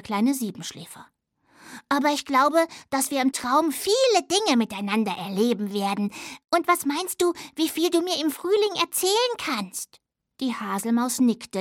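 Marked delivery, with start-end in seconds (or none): 1.9-10.0 lightly voiced little dormouse; a young dormouse, just a touch brighter; little dormouse child. Little dormouse comforts friend. (leicht verstellte Stimme eines kleinen Siebenschläfers)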